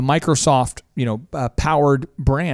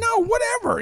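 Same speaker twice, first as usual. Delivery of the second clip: much higher, softer "whatever"; dismissive brush-off; 5 dB quieter than usual. Retold or imitated. Host shrugging off the complaint, dismissive. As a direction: wave it off lightly. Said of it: "You're like".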